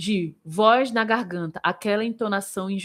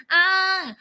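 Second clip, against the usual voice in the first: sustained higher nasal "ah"; nasalized; 7 semitones higher, 4 dB louder, much slower than usual. Shown as a demonstration. Singing teacher showing students the nasal resonance region, nasalized. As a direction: send the held ah into the nose. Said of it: "um pouco mais nasalizada".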